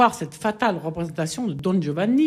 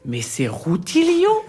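astonished discovery; 9 semitones higher, slower than usual. Acted. Spotting the lost tenor.

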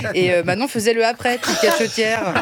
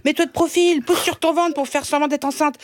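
higher, curt photographer's orders; bossy casting director; 6 semitones higher than usual. Retold or imitated. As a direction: bark out poses like a pushy casting director. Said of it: "Il était là".

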